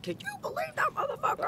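very high, fast, soft rant; hammed-up rapid falsetto venting; mock venting. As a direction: squeak out a comic complaint to a partner. Re-enacting venting to Patrick.

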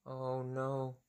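very soft, low "oh no"; quiet, sinking storybook dread; hushed dismay. Acted. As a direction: shrink to a tiny guilty murmur. Gerald's dismay after outburst.

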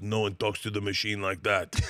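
light mock Russian gangster; tough gangster. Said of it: "and goes".

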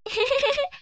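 playful giggle. Acted. Birds playing and laughing.

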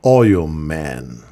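deep, booming superhero title; mock epic hero announcement, very low; announcer voice. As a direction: boom it out like a superhero movie trailer. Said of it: "ele tava assim".

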